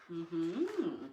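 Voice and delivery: ordinary delivery, nothing marked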